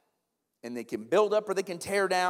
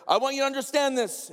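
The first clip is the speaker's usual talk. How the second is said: lightly imitated college president, raised voice; Doctor Haug; 6 semitones higher, 4 dB louder than usual. College president urges students to understand, lightly imitated and emphatic. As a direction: urge the point in a higher, emphatic voice. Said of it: "he would say that".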